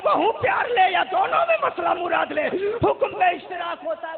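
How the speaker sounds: loud, insistent sermon delivery; forceful preaching. Real, not acted. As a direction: drive the argument home at full voice. (ज़ोरदार तकरीर, ऊँची आवाज़ में)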